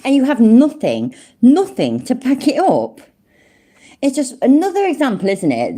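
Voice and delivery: real heated exasperation; indignant. Real, not acted. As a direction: hammer the complaint, voice climbing in disbelief. Indignant at claims without evidence.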